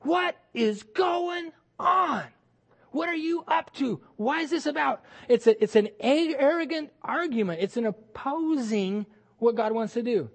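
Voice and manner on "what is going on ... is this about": a higher, slow questioning voice thrown up at God; debating with God. Retold or imitated. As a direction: protest to God in disbelief. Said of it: "where you debate with God".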